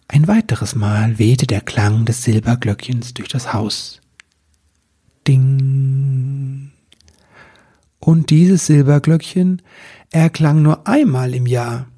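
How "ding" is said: a long, ringing ding; ringing bell sound. A narrator's reading. Narrator sounding the silver bell, drawn-out ring. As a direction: let the ding hang like a bell.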